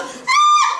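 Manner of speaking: hammed-up movie scream. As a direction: scream like a horror heroine. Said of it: "She'd go".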